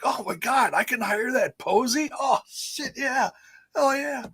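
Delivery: lightly hammed-up eager realization; mock excitement. Mimics an excited Tony Khan.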